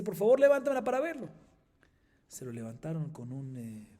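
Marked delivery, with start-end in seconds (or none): 0.0-1.3 put-on high, loud, quick plea from the old rabbi; pitched-up pleading elder. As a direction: beg your students to lift your brows, fast and loud. Old rabbi asks students.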